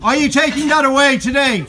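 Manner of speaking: high, sharp demanding shout; loud sharp voice. Real, not acted. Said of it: "Stop shouting at me".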